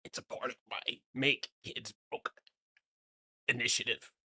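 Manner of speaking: ordinary talk, nothing marked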